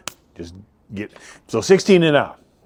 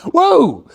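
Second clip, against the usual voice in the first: high loud whoa; a loud delighted whoa; excited whoa; 7 dB louder than usual. Real, not acted. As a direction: yelp it out in glee. Excited yelp about horse Viagra.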